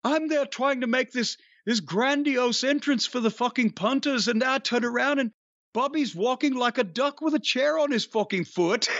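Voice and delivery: put-on quoting Adrian's English accent with a laugh building. Wrestler retelling Adrian's botched entrance story, in light English accent. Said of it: "in an Adrian's accent".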